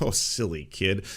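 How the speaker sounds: slow, patronizing chuckle of an adult; mock indulgent dismissal. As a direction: brush a child off lazily. Voices adult brushing off child.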